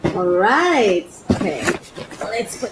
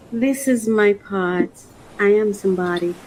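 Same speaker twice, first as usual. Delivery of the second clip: composed affirmation; 4 semitones lower than usual. Real, not acted. Vlogger affirming her worth through her pot, composed. Composed statement after squealing it.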